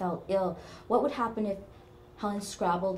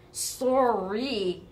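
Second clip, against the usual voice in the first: higher, drawn-out "sorry"; flippant apology; 5 semitones higher, 6 dB louder than usual. A narrator's reading. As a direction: stretch it out defensively. Teased coworker gives flip apology.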